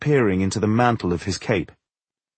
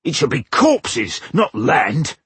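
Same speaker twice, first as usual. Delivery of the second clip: high, forceful brutish voice; raised, derisive creature voice; scornful orc; 5 semitones higher, 3 dB louder than usual. A narrator's reading. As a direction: argue back as a contemptuous orc chieftain. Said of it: "grunted Ushnots scornfully".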